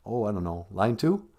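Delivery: usual speaking voice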